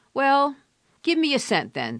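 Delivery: loud, high, cheeky offer; a newsboy's loud comeback; bold newsboy. A narrator's reading. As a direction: call out the bargain with a grin. Newsboy bargaining with old man.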